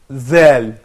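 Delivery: "dhal" as a light non-emphatic voiced th; plain dhal. Shown as a demonstration. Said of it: "we say".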